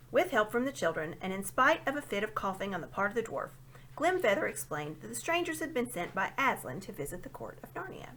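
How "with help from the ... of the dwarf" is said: plain reading voice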